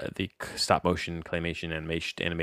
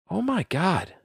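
disbelief; 10 semitones higher than usual. Real, not acted. Disbelief at ratings.

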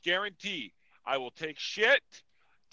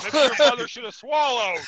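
retold loud comeback; 6 semitones higher, 10 dB louder than usual.

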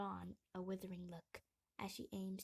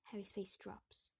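plain reading voice

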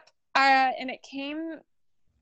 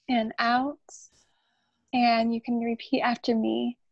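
guided meditation. Acted. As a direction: lead the breath and mantra gently. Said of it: "you're gonna repeat after Brittany".

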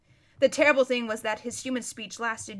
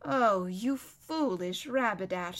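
slow, sighed reproach; sighing; 2 semitones lower, slower than usual. Acted. Lucy to the foolish prince, sighing. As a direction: let it out on a weary sigh. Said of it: "sighed Lucy".

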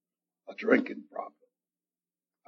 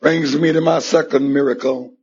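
usual speaking voice

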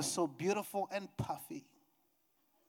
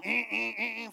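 vocal imitation of a vibrating phone; buzzing phone imitation; 4 semitones higher than usual. Preacher imitating his phone vibrating, buzzing hum. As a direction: buzz in short repeated hums. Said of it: "Started vibrating in my phone".

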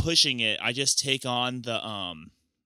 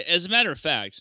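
mock serious; 5 semitones higher, 4 dB louder, faster than usual. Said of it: "no, I'm kidding".

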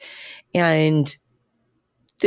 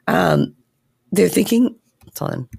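ordinary talk, nothing marked

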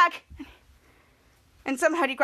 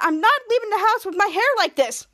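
high-pitched, whiny protest; indignant refusal; 6 semitones higher, 4 dB louder than usual. Acted. Piper refusing to go out with Henry, indignant. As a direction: refuse point-blank like an upset girl.

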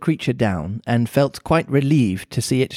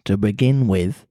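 put-on Mancunian accent; 4 semitones lower than usual. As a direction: lower Mancunian cat voice. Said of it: "pretty Mancunian sounding accent".

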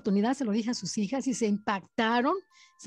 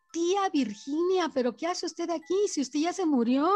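astonished; 6 semitones higher than usual. Retold or imitated. Retold shock at seeing dead aunt.

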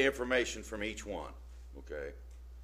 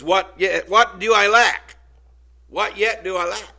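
put-on demanding young man; 7 semitones higher, 12 dB louder than usual. Young man pressing Jesus, insistent. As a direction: press the question proudly.

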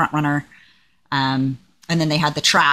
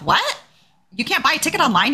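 "What?" jumps way up in pitch, then stays high in disbelief; incredulous; 8 semitones higher than usual. Retold or imitated. Retelling her shock to the bus driver, incredulous and high-pitched. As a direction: squeak out "What?" like you can't believe it.

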